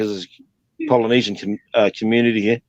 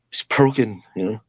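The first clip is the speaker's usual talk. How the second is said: usual speaking voice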